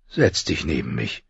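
low, soft fatherly voice; not unkindly. A narrator's reading. Father asks daughter to sit by him, kindly. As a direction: invite her gently in a low, quiet tone. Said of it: "sagte er nicht unfreundlich".

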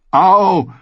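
loud, high yelp; scolding cry of pain. Acted. Gendry hit on the head by Arya, indignant yelp. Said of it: "schimpfte er".

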